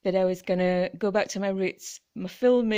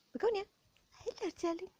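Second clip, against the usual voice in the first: cooing; 9 semitones higher, 14 dB quieter, much faster than usual. Real, not acted. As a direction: coo softly to the cat. Cooing to her pet.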